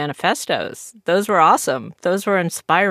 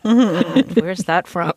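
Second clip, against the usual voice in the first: laughing; 2 semitones higher, 3 dB louder, much slower than usual. Real, not acted. Laughing at the quote.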